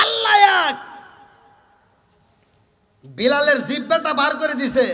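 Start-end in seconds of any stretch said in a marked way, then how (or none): 0.0-0.8 high, loud cry; shouted declaration. Acted. Preacher crying out Allah is one to the crowd, loud and high. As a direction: call it out to the whole gathering.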